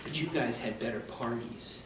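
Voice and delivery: playful hushed confession; mock confidential. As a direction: let them in on it, softly and slowly. Jokey secret confided to the class.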